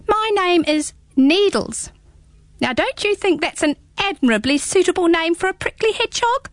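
squeaky small creature, teasing; little hedgehog voice. Acted. Hedgehog announces its name.

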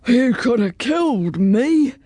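put-on dragon voice, taken aback; exhausted dragon after a battle. A narrator's reading. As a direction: speak as a winded, puzzled storybook dragon. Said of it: "said the dragon".

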